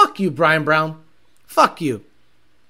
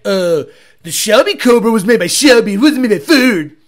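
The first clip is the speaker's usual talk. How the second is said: know-it-all viewer; 7 dB louder than usual. Retold or imitated. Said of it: "you would be like".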